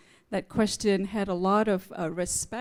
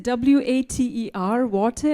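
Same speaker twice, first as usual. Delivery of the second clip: W A T E R spelled slowly, letter by letter, louder; slow louder spelled W A T E R, then water with a rise; spelling it out; 3 semitones higher, 5 dB louder than usual. Retold or imitated. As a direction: spell out each letter of W A T E R patiently. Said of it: "And I said".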